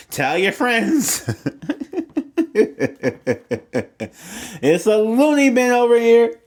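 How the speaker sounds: high laughing outburst, then a loud cry; laughing loudly. Real, not acted. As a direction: laugh it out, tickled by herself. Laughing at her own weirdness.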